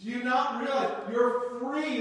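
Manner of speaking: usual speaking voice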